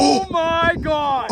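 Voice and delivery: high, long-stretched exclamation; awestruck excitement. Real, not acted. Angler first glimpses the huge fish, awestruck. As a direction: draw it out in real amazement. Big fish comes into view.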